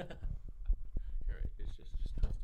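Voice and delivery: hushed whisper while checking the mic. Real, not acted. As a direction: whisper it under your breath. Checking if the mic still records.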